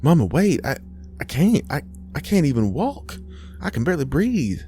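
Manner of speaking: high, faltering plea; distressed pleading. A narrator's reading. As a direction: beg your mother not to leave you behind. Helpless daughter begs mother to wait.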